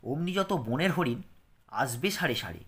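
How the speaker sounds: put-on lilting, rhythmic poem reading for children; expressive recital for a class; poem recitation. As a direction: recite it with a gentle sing-song beat.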